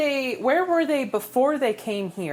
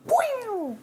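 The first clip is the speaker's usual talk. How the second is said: high boing; 13 semitones higher than usual. Retold or imitated. Slingshot sound effect.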